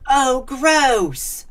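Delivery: loud, high-pitched cry of disgust; disgusted shout. A narrator's reading. Sister shouting at the party as a kid throws up, disgusted. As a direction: shout it out, grossed out. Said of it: "someone shouted".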